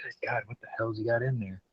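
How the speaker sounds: whispered in disbelief. Retold or imitated. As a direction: hush it like you can't believe your eyes. Shocked aside to himself.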